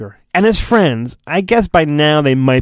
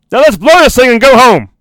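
loud, higher triumphant yell; shout; 7 semitones higher, 7 dB louder, faster than usual. Acted. Han urging Luke to finish the Death Star, loud shout. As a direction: belt it out like a victory cry. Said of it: "the loudest Han has ever spoken".

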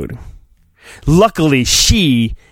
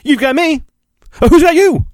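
high mock Obi-Wan; 12 semitones higher, faster than usual. Obi-Wan reply, comic.

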